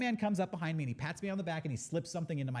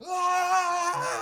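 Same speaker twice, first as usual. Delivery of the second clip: high, loud shout of "Ah!"; adrenaline scream; 12 semitones higher, 5 dB louder than usual. Retold or imitated. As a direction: let out a rush-of-adrenaline yell. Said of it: "he gets out and he's like".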